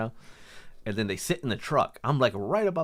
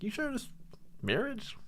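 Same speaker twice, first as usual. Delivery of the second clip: gentle warning; 14 semitones higher, 8 dB quieter than usual. Retold or imitated. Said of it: "warn him".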